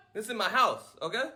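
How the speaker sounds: normal talking voice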